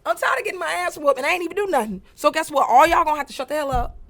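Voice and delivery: mock-angry big sister laying down the law; fed-up outburst. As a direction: announce it like the kid who's had enough. Retold fed-up childhood outburst.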